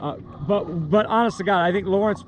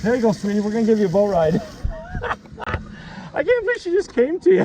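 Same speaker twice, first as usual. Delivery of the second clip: cooing to the fish; 7 semitones higher than usual. Real, not acted.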